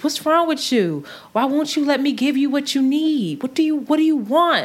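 a hint of a tipsy man pressing for attention; pushy drunk man. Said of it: "He goes".

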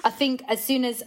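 ordinary talk

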